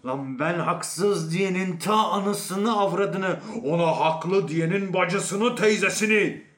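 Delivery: high, drawn-out angry curses, loud at the end; furious cursing. Acted. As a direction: stretch out the insults, building to a shout. Man curses both sides furiously.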